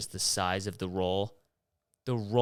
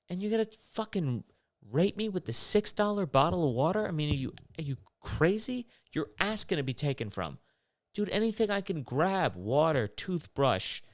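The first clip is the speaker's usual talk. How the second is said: outraged rant; 7 semitones higher than usual. Real, not acted. Outrage at airport prices.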